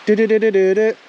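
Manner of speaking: high chirpy birdsong mimic; bird call imitation. Mimicking the bird for his viewers, high chirpy trill. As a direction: copy the bird's trill up high. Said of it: "that bird that's going".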